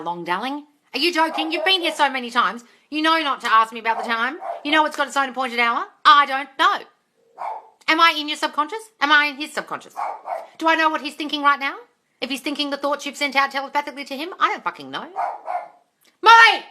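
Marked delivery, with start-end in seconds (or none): 0.9-15.1 higher, louder fed-up rant; exasperated shout. Real, not acted. 16.2-16.6 very high, loud call to the dog; shouting at the dog. Real, not acted. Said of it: "for shouting at the dog".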